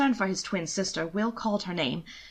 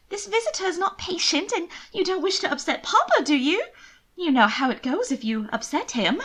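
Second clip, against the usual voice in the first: high-pitched anxious pleading; worried pleading; 9 semitones higher than usual. Acted. Worried sister urging her twin not to upset Papa. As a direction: press him with fretful urgency. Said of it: "the picture of worry".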